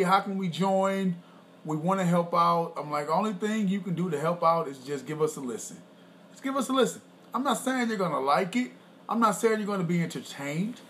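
ordinary talk

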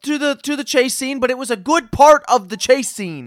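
loud, high, insistent shouting; worked-up, emphatic self-defense; heated arguing. Real, not acted. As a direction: argue the point at full volume. Arguing a film detail loudly.

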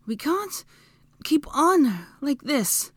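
high, halting, slow words; panting. A narrator's reading. Hermione winded after the fight, panting. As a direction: pause for breath mid-sentence. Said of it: "Hermione panted".

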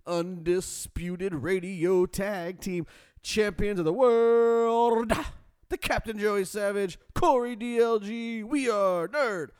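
booming ringmaster announcement; slow, drawn-out announcer hype. Acted. Announcer-style show intro.

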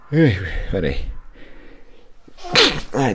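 recovering from a sneeze; apologizing right after sneezing. Real, not acted. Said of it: "vou espirrar aqui".

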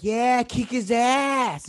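slow, high cheering yell. Retold or imitated. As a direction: egg on the fight. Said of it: "I was all like".

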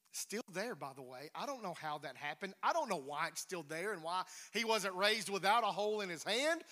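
ordinary talk, nothing marked

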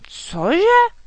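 high, loud outraged screech; furious roar. A narrator's reading. Dragon girl hearing her beloved insulted, roaring furiously. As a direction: explode in disbelief. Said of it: "zaryčela Lucinka vztekle".

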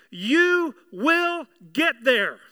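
"You will get there" very slow, each word weighted; "You will get there" spaced out word by word; emphatic assurance. Real, not acted. Preacher drives home the good news.

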